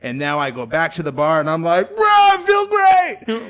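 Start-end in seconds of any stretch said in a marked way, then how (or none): 1.9-3.5 put-on much higher, loud, drunk bro voice; drunk bravado. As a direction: sell it like a drunk who swears he's fine. Drunk after vomiting, bragging.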